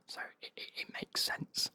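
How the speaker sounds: ordinary delivery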